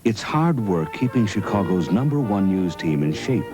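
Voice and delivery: normal talking voice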